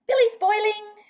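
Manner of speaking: high, loud, bright call; overly cheerful father voice; father sounding too cheerful to his daughter; cheerfully. A narrator's reading. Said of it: "said Papa cheerfully".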